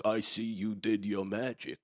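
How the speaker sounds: soft, slow, half-laughing read of dad's smug text; mocking dad's message.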